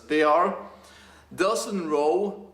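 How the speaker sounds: ordinary talk, nothing marked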